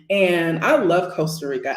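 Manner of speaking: ordinary talk, nothing marked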